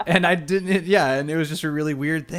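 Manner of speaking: ordinary talk, nothing marked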